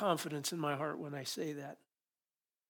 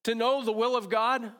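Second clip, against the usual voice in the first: emphatic quote; 6 semitones higher, 6 dB louder than usual. Retold or imitated. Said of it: "He says".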